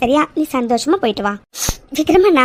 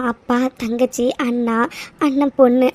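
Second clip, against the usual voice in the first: ordinary talk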